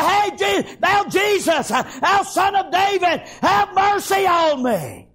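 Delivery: high, sustained pleading cry; insistent holler. Acted. Blind beggar refusing to be hushed, insistent holler to Jesus. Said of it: "he hollered the more".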